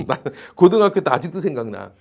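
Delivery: ordinary talk, nothing marked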